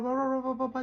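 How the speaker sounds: high-pitched rapid gabble of nonsense syllables; too-fast reading. Shown as a demonstration. Teacher mimicking people who read so fast, gabbled high. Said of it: "People read so fast".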